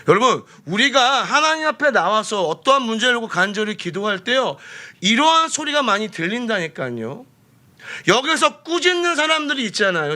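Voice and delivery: high, urgent pulpit voice; forceful preaching. Real, not acted. Preacher warning praying congregation of discouraging voices, urgent and high. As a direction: press the point on the listeners.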